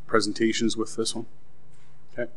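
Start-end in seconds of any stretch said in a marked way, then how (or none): none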